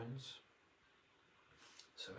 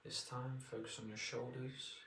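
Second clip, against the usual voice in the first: guided meditation; 4 dB quieter than usual. Acted. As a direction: ease them into relaxation.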